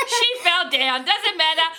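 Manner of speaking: high-pitched, laughing through the words; real laughter while signing off. Real, not acted. Laughs as someone falls running in.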